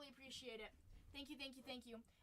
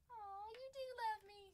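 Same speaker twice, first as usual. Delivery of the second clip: high, cooing melt; touched pet owner; 8 semitones higher than usual. Acted. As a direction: coo it to an excited animal. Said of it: "You're like".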